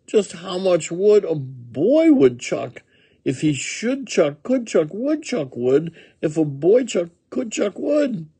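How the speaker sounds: high-pitched woodchuck riddling; thoughtful woodchuck. A narrator's reading. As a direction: muse through the tongue-twister in a high voice. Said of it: "with a thoughtful cluck".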